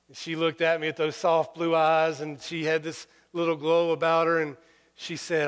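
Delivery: ordinary talk, nothing marked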